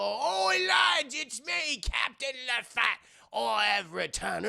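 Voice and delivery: high, slow, hammy pirate hail; grand mock-pirate shout; fake pirate captain. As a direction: announce yourself as the returning captain. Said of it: "he finds a pirate outfit".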